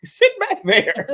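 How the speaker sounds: very high, slow squeaky voice; laughing falsetto kid. Retold or imitated. Voicing kid's reply.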